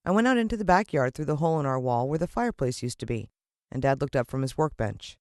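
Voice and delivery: plain reading voice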